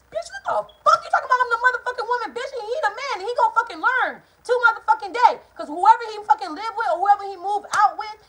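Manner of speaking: loud, high-pitched angry tirade; heated retold shouting match; furious retort. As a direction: yell it back in outrage. Acted quarrel answering the cousin.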